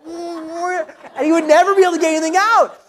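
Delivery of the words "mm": muffled, high closed-mouth mumble; mouth full. Retold or imitated. Son caught by the waiter mid-bite, mouth full. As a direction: imitate trying to answer through food. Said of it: "like, a mouthful of food".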